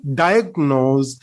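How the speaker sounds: "Diagnose" with the weight on "Di"; first-syllable stress. Shown as a demonstration. Teacher saying it correctly for students, first-syllable stress. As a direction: stress "Di" in "Diagnose", "nose" lighter. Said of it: "The first syllable is stressed".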